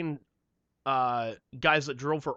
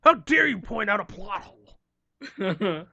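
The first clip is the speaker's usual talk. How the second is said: 1 mock outrage; 6 semitones higher, 4 dB louder, slower than usual. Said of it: "I'm just like".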